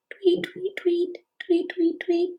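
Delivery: high, whispered tweeting; breathy high bird calls; birdie tweets. Shown as a demonstration. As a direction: chirp softly like a tiny bird. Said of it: "And a bird goes".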